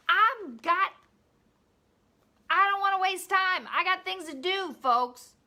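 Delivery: loud, high-pitched protest of being too busy; indignant mock rant. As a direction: holler it out like an impatient, hammed-up excuse.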